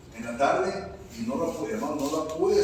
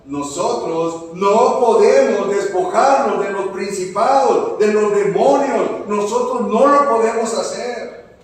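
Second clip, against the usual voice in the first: full-force preaching; 6 semitones higher, 11 dB louder than usual. Real, not acted. Pastor declaring God's freedom to the congregation, preaching at full force.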